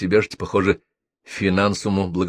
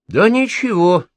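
higher and louder, biting; sarcastic; 13 semitones higher, 6 dB louder, slower than usual. A narrator's reading. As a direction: sneer it mockingly. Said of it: "язвительно сказал я". (язвительно)

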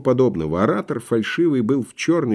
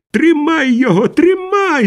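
high-pitched, cracked, angry cry; spiteful, whining shout; 4 dB louder, slower than usual. A narrator's reading. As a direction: yell it high, mean and tearful. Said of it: "закричал мужской, надтреснутый, злобный и плаксивый голос". (злобный, плаксивый, надтреснутый крик)

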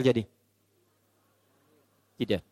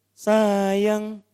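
sweet call; 3 semitones higher than usual. Acted. Husband sweetly calls his wife.

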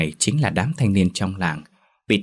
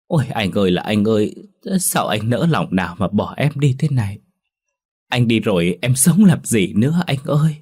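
wailing lament; 3 semitones higher than usual. A narrator's reading. Linh grieving her dead lover, a touch of wailing. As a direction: mourn her lover, mild crying voice. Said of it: "Con Linh khóc".

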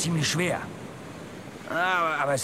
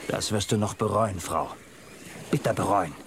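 low threat; 9 semitones lower than usual. Acted. Husband threatens wife.

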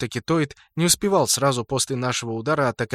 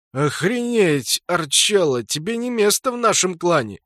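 mocking; 6 semitones higher, 3 dB louder than usual. Sprut mocks laughing.

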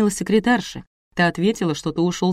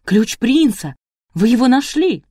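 loud, high astonished cry; astonished excitement; 6 semitones higher, 5 dB louder than usual. A narrator's reading. Boy learns the prince's key was found, astonished. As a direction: exclaim it with rising disbelief.

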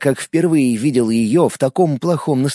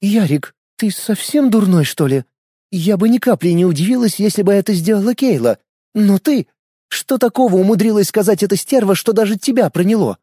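exasperated scolding; 7 semitones higher than usual. A narrator's reading. Lena scolds Yaroslav.

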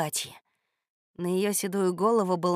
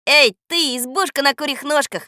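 loud, raised, rhythmic command; shouted incantation; 5 semitones higher, 11 dB louder than usual. A narrator's reading. Boy ordering the magic hut to turn round, shouting. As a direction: call the spell out boldly. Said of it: "закричал Женька".

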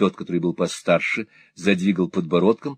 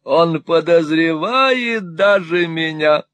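put-on high, wheedling old miser voice; fawning old moneylender; 10 semitones higher, 4 dB louder, slower than usual. Old usurer fawning on Ralph, high and wheedling. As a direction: simper it like a cringing usurer. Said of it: "вскричал старый Артур".

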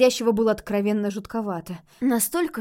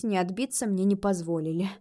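usual speaking voice

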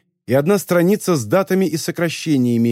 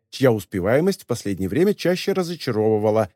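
ordinary delivery, nothing marked

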